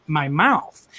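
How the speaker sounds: ordinary talk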